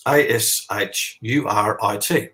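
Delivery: each letter of "A S H U R I T" spelled out slowly, one by one. Shown as a demonstration. Teaching viewers the name, spelled slowly letter by letter. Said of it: "a language called Ashurit".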